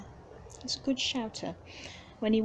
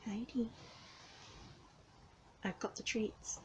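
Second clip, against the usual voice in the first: coaxing whisper; 10 dB quieter than usual. Real, not acted. Coaxing hiding cat softly.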